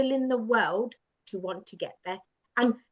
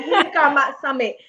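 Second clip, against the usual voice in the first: loud, higher mock triumphant cry; Bollywood triumph; 4 semitones higher, 8 dB louder than usual. Imagining a Bollywood summit scene, a loud mock triumphant cry. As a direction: cheer like a Bollywood hero. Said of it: "like a Bollywood movie scene".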